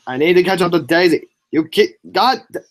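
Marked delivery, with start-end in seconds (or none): none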